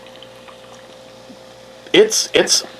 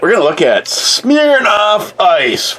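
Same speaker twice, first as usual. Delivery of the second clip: drawn-out showy announcer; 8 semitones higher than usual. Pretend. Vlogger introducing drink to viewers, mock announcer. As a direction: present the drink like a game show host.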